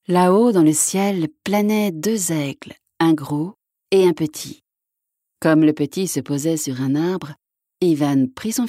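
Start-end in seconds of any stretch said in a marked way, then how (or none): none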